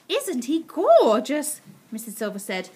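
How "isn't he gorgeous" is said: slow, loud, high doting gush; beaming adoration. A narrator's reading. Missus Silver beaming up at Mister Hoppy, slow adoring gush. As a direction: gush proudly, stretching it out. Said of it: "looking up and beaming at him".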